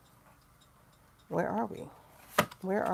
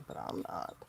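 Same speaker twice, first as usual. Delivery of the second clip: whispered, much lower and softer; whispered aside; 12 semitones lower, 9 dB quieter than usual. Real, not acted. Muttering to doomers that she is fine, whispered. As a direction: drop to a whisper for the jab.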